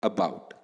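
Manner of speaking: weak first syllable, firm falling BOUT in "About"; second syllable stressed. Shown as a demonstration. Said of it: "pronounced louder than the first syllable".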